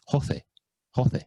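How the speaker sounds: "Jose" said "ho-SEH" with a throaty H; Spanish "Jose" with a guttural H. Shown as a demonstration. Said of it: "With a bit of guttural from the throat".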